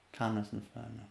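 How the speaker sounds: teacher showing a calm firm voice. Shown as a demonstration. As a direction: say it calmly, almost hushed. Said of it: "Like that".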